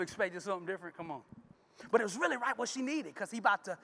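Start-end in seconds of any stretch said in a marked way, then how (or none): none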